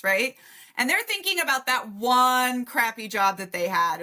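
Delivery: ordinary delivery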